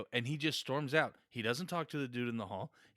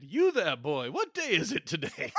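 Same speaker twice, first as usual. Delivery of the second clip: hammed-up old-timey English gentleman calling out; Dickensian kid; 5 semitones higher, 6 dB louder than usual. Host playing a Victorian gent hailing a street boy, hammy and loud. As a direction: call out grandly to a street boy. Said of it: "I wanted some little, like, Dickensanian kid".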